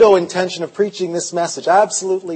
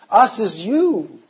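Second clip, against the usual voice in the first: ordinary delivery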